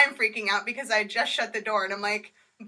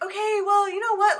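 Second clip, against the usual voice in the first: much higher, louder chipper voice; mock agency worker; 10 semitones higher, 5 dB louder than usual. Agency clerk redirecting the caller, chirpy and high.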